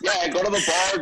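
high laughing aside. Real, not acted. Joking with the host about bars, high laughter.

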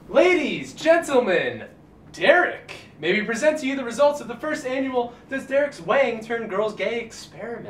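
grand showman announcement; announcer voice. Acted. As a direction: present it like a ringmaster. Formal mock presentation.

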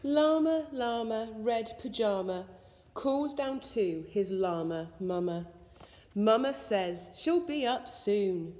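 ordinary delivery, nothing marked

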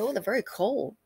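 usual speaking voice

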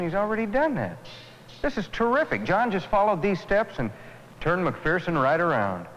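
high-pitched, thrilled outburst; excited amazement. Acted. Onlooker amazed John won over McPherson, excited. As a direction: marvel at it, voice lifted high.

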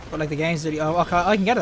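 ordinary talk

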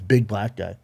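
ordinary delivery

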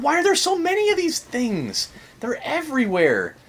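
high-pitched exasperated complaint; overwhelmed alarm. Real, not acted. Player surrounded by these things, high overwhelmed whine. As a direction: voice jumps up in dismay.

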